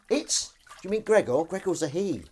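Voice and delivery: high, loud, offended question; indignant surprise. Real, not acted. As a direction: react as if shocked by the mix-up. Mock outrage at a pronoun.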